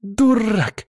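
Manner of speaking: high furious yell. Acted. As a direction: scream it right in his ear. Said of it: "раздался вопль Эйки".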